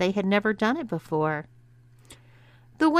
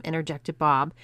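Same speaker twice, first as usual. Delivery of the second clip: ordinary delivery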